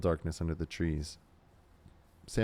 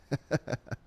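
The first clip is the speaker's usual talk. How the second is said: laugh; 8 semitones higher than usual. Real, not acted. Laughing at own voice.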